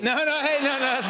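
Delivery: high, loud laughing no-no; laughing protest. Real, not acted. Host laughing at guest's cheeky line, laughing protest.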